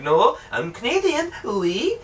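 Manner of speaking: badly faked French woman's accent; mock French accent. Canadian girl insisting she's Canadian, mock French accent. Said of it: "chick who speaks, like, super fucking French".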